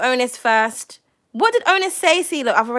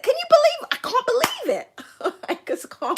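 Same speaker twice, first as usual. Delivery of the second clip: much higher, laughing through the words; laughing disbelief; 11 semitones higher than usual. Real, not acted. To viewers about Kaylee being in tomorrow, high laughing disbelief. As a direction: giggle it out, unable to believe it.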